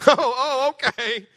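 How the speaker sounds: high laughing scoff; mocking laugh.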